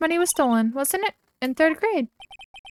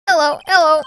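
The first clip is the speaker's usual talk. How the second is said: a light, squawky parrot imitation, higher and louder; 5 semitones higher, 7 dB louder than usual. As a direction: chirp it out like a talking parrot. Voicing the parrot Polly.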